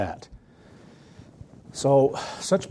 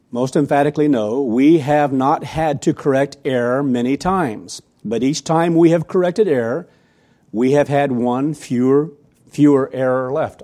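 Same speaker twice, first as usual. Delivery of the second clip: loud, deliberate sermon reading; emphatic reading aloud; 6 dB louder than usual. Acted. Preacher reads a church leader's letter to congregation, emphatic. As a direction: read it out forcefully, weighing each phrase.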